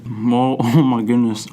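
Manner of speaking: ordinary talk, nothing marked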